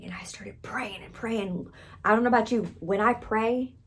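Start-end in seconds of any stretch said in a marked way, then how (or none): 0.0-1.7 hushed whisper. Retold or imitated. Preacher recalling her kitchen prayer, whispered. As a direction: let it drop to a breathy whisper.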